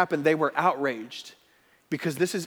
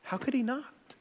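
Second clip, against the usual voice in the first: softer, higher searching question; quiet; 7 semitones higher, 8 dB quieter than usual. Acted. Preacher quietly asking the congregation, searching. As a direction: ask it gently into the silence.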